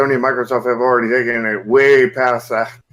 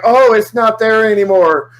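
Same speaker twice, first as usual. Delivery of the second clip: mock innocent company; 8 semitones higher, 4 dB louder than usual. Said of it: "be like".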